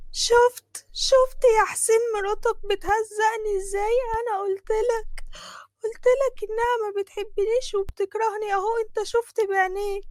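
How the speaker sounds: high, whiny fake sobbing complaint; fake crying. Fatma fakes tears to husband. (بكا مصطنع بصوت عالي ومتذلل)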